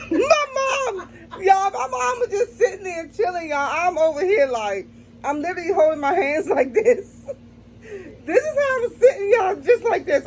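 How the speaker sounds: high, strained laughing sobs through the burn; real laugh-cry from spice. Real, not acted. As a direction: talk while laughing and crying from the heat. Laughing crying from the heat.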